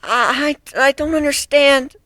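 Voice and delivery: stumble, unsure of yourself; frightened accused griffin; nervous young griffin. Acted. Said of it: "the gray-feathered griffin responded".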